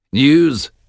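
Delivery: lightly voiced anxious king; a hint of a worried old king. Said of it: "said King Duncan anxiously".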